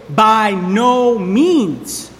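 "by no means" stretched out very slowly, word by word; slow emphatic quote. Shown as a demonstration. Pastor showing the congregation the phrase, slow and weighty. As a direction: drag out "by no means" so each word lands. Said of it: "strong adversative no in the phrase".

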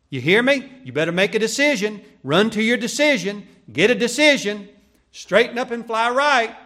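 high, loud hectoring orders; hectoring demand. Acted. Preacher imitating one who pushes decisions on people, hectoring. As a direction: bellow it like a scolding, demanding preacher.

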